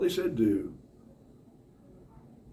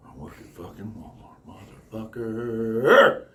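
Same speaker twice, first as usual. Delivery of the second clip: disgruntled Walmart worker; 6 dB louder, slower than usual. Acted. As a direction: start under your breath, end in a long growing roar. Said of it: "Walmart, they're like".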